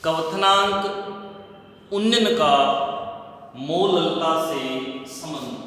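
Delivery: very slow, drawn-out words with pauses; slow dictation. Shown as a demonstration. Teacher giving students the topic title, slow dictation. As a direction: dictate it phrase by phrase, slowly.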